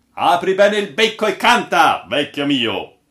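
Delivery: high, loud call to begin; hearty urging. A narrator's reading. Captain urges the old sailor to tell his tale, boisterously. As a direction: egg the storyteller on boisterously.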